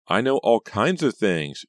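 slow, loud, singsong hint; teasing. A narrator's reading. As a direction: draw it out to toy with her. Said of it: "deliberately toying with Pam".

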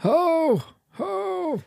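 high squeaky ho ho; jolly ho ho ho. Acted. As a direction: falsetto jolly laugh. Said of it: "Santa laugh again".

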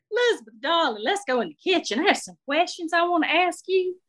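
high-pitched, coaxing Southern matron; nosy older Southern lady. Acted. Shirley wants Elizabeth alone for questions, nosy Southern lady. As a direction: draw her aside as a curious old Southern lady.

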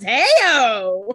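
loud, drawn-out gleeful whoop; hammed-up victory call; mock triumphant cheer. Retold Connor cheering at success.

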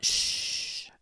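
soft whispered shush; imitated baby shush. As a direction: hush her like a sassy baby. Baby niece shushing her.

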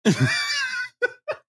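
shrill burst of laughter; high squeal laugh. Real, not acted. Reacts to the joke.

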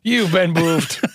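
slow goofy drawl on boofed; silly mock accusation, stretched out. Jokey pun on spoofed.

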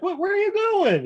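very high, mock bewildered call; hammed-up dismay; calling after them. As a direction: squeal it after a fleeing crowd. Retold imposter calls after fleers.

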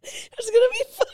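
laughing. Real, not acted. Whispering through laughter.

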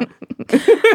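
a short high laugh. Real, not acted. Laughs after Sally impression.

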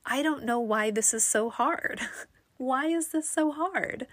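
exasperated plea with a laugh in it; mildly exasperated, half laughing; retold frustration. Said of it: "I was feeling super frustrated".

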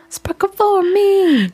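high, slow singsong; high sparkly voice. Acted. Playful aside after laughing.

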